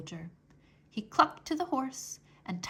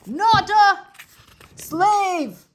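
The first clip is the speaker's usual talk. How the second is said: very loud, higher shout; 9 semitones higher, 14 dB louder, much slower than usual. Acted. Soldier shouting to deaf Mig that she's free. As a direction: drive it home at full voice. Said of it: "shouted the soldier".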